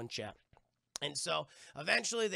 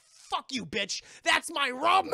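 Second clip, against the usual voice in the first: mock angry shout; 9 semitones higher, 8 dB louder, slower than usual. Jack cursing Elizabeth.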